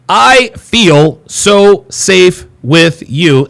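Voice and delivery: very slow, drawn-out reveal of each word; dramatic title read. Acted. Host to viewers, unveiling password one: very slow dramatic title read. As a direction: let the phrase hang like a title card.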